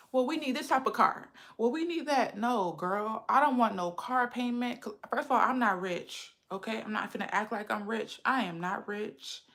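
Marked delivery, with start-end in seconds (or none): none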